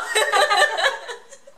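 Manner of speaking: laughing after asking the singer for a shout-out. Real, not acted. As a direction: burst out laughing.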